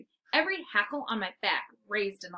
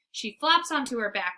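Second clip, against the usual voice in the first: ordinary delivery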